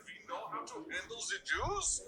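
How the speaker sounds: mock German flight attendant accent. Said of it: "with her very thick German accent".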